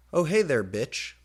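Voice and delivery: a light mock-emo greeting, high then dropping. Professor greeting the girl in a mock emo voice. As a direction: sneer it like an emo. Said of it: "said in an emo voice".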